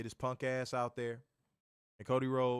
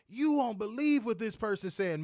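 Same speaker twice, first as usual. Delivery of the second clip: high, loud, breathless-gossip mimicry; mock scandalized fan; 10 semitones higher, 5 dB louder than usual. Fans rushing over to report what someone said, mock astonishment. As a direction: imitate an astonished fan bringing news. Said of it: "y'all will come over here and be like".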